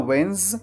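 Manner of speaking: "वेंस" as wenz, no d; first syllable. Shown as a demonstration. Teacher splits the word for learners, isolated "wenz". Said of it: "पहले आपको बोलना है".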